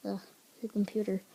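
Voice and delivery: ordinary delivery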